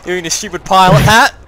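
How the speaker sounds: ordinary delivery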